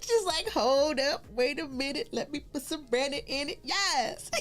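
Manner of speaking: high voice bubbling into laughter; amused joking. Real, not acted. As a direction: joke along, tipping into a laugh. Laughs through joking aside to chat.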